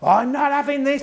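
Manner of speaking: mock angry bawl. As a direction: imitate a senior manager bawling at a class. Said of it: "bawling at all the kids".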